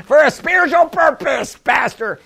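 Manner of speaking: high, put-on congregant voice; mock objecting congregant. Mimics congregant objecting to the pastor.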